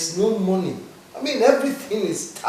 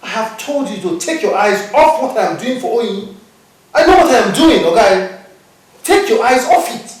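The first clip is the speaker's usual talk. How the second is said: loud, heated rebuke, rising to a yell; furious brother barking at a begging sibling; angry shout; 3 semitones higher, 13 dB louder than usual. Acted. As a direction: shout him down, warning him off.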